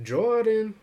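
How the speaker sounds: imitated high, loud shout of a name; mock call-out. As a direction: call it out like people do. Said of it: "the way people are like".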